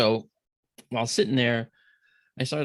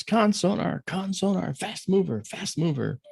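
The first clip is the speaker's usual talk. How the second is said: high-pitched urgent call-out, lightly projected; retold yell; 8 semitones higher, slower than usual. Sonar officer alerting the control room, retold high urgent yell. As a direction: imitate an officer calling the alarm, easing off at the end. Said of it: "the sonar officer yells".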